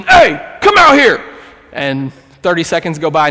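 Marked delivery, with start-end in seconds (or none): 0.0-1.2 loud, high-pitched shout into the distance; acted-out challenge, hollered at full voice; yelling. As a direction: yell to call someone out of hiding. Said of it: "Wes is yelling".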